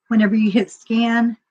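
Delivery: usual speaking voice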